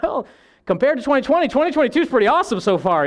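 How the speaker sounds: high-pitched, playful comparison; comic reconsidering. Acted. People comparing this year to twenty twenty, high comic reconsidering. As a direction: push the voice up high for the punchline. Comic reply looking back.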